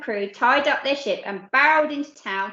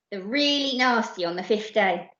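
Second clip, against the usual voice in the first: plain reading voice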